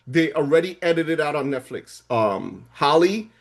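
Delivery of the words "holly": ordinary talk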